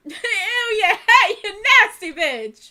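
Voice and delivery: loud laughing tease. Real, not acted. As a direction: giggle out the playful insult. Friend tries sour combo.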